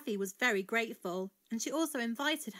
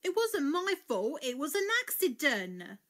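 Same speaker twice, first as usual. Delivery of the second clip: put-on loud, higher, defensive child's protest; angry; 6 semitones higher, 4 dB louder than usual. A narrator's reading. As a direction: protest crossly at being blamed. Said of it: "Justin said angrily".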